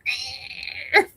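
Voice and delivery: very high fingernails-on-chalkboard squeal; grating screech. Shown as a demonstration. Host mimicking a grating voice, high mock screech. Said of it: "one of those grating".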